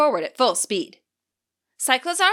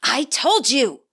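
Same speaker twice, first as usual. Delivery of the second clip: slow, pressed hiss through the teeth; 2 semitones higher, slower than usual. Acted. Ortega hissing back at Penny, slow and tense. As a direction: slowly hiss it back, annoyed. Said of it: "Ortega hisses back".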